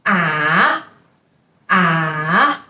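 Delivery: ah dipping low then rising; third tone ah. Shown as a demonstration. Teacher modelling the dipping third tone. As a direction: dip ah down low and bring it back up. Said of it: "So pronunciation is".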